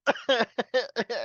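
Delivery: high-pitched trailing laughter. Real, not acted. Host still tickled by a co-host's answer, laughing.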